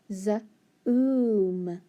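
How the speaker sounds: Z-oom with a long drawn oo; stretched Z-oom. Shown as a demonstration. Teacher sounding out Z-oom with the oo. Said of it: "let's try it with the oo".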